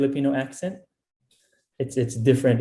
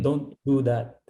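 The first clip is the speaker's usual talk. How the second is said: slow "Don't do dat"; Filipino accent. Shown as a demonstration. Guest showing how TH becomes D, Filipino accent. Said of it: "yung T H naging D".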